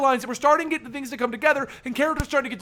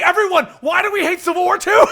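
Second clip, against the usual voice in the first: rally everyone loudly, half laughing; host shouting to the group to kick off the rant, loud; 6 semitones higher, 7 dB louder than usual. Real, not acted. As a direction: shout it out to the whole room.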